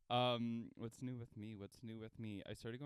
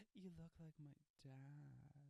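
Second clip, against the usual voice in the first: soft, slow, slightly higher mock confession; awkward confession; 4 semitones higher, 9 dB quieter, slower than usual. Imagining telling the instructor, slow and soft. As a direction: draw it out softly and awkwardly.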